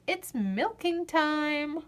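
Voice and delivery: drawn-out singsong refrain; lilting storybook refrain; slow refrain. A narrator's reading. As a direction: stretch it out like a chorus. Refrain read slowly.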